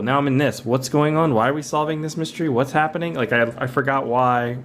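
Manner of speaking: ordinary talk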